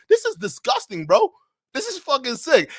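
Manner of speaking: high, loud disgusted outburst; disgusted outrage. Real, not acted. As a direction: cry out how sick it is. Host outraged by guest.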